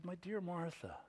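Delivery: very soft, tender reassurance; compassionate. Acted. Preacher as Jesus to Martha, not mad but softly compassionate. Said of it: "No, he says".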